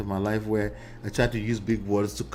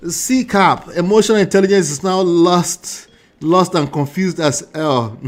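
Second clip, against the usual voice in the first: mocking exasperation; 4 semitones higher, 8 dB louder than usual. Real, not acted. Mocking the guest loudly.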